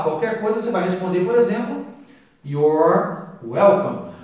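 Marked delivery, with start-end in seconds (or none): 2.4-4.1 You're said like "your", very slow; You're sounds exactly like your. Shown as a demonstration. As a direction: stretch out You're as one syllable "yor". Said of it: "pronúncia do you are abreviada é your".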